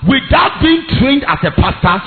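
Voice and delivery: loud, fired-up preacher shout; preaching at full force. Real, not acted. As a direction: preach it hard to the crowd.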